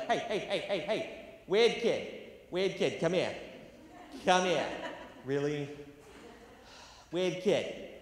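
loud mocking hallway taunt; taunting bully. Schoolmate jeering the new kid, loud mocking call. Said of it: "someone in the hallway would be like".

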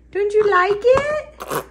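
slow, very high cooing question. Real, not acted. Mother asks baby if apple is liked, drawn-out baby talk.

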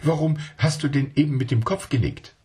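put-on high, eager little child asking its uncle; a small child not believing a word; child's voice.

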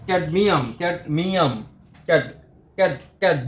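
ক্যাডমিয়াম repeated, then cut to ক্যাড ending on d; syllable sounded out. Shown as a demonstration. Teacher showing cadmium takes a d, ক্যাড isolated and repeated. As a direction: sound out ক্যাড in separate bursts to expose the d. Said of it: "ডি এসে, ক্যাড".

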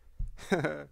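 sudden high-pitched burst of laughter. Real, not acted. Vlogger laughing at a player's name, high laugh.

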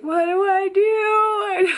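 high, loud and slow helpless cry; frantic plea for help at a fire; panicked wail. Retold or imitated. Panicking over the stove fire.

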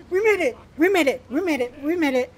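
high, excited repeated cheer; triumphant cheer. Real, not acted. Player cheering after beating the timer, elated. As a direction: celebrate the win, half laughing. Mission won at last.

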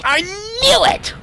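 high squeal of vindication, drawn out; triumphant cry. Real, not acted. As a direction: crow it in a high voice. Triumph on finding the tape.

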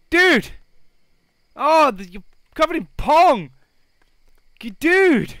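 high, loud grossed-out shout; loud gross-out shout at a smelly sim; disgusted yell. Real, not acted.

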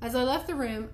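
ordinary delivery

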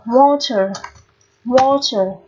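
"water" with a long open O in the first syllable. Shown as a demonstration. Teacher modelling for students, long O in "water". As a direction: hold the A of "water" as "aw". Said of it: "cũng là âm O dài".

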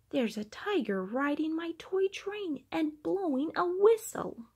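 usual speaking voice

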